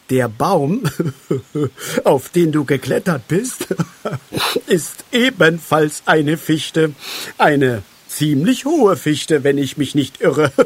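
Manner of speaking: loud, chuckling between phrases; amused. A narrator's reading. Moosbart tells Halbschuh which tree he climbed, amused. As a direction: laugh your way through the explanation. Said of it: "Moosbart lächelte".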